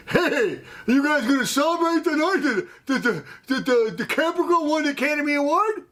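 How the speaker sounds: high-pitched imitation of an excited coworker; imitated excited question, pitched right up; mock excited teasing. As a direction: needle them in a high, eager mock voice.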